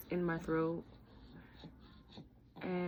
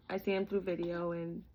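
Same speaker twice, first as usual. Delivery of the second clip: normal talking voice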